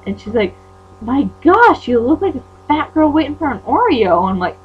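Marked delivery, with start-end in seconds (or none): 1.0-4.3 high, loud mimicked insult; mocking imitation. Girl quoting Carly's fat-girl insult, mocking. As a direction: mimic a rival's scornful jab, pitched up.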